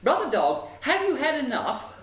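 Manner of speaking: high, lilting bird question; chirpy sparrow, pitched well up; sparrow's voice. A narrator's reading. Sparrow asking brother dog if he is full, high and lilting. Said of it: "the sparrow asked".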